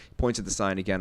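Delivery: ordinary talk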